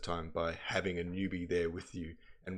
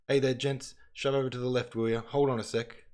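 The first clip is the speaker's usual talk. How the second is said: calling out; 5 semitones higher, 5 dB louder than usual. Acted. Convoyman shouting at soldiers.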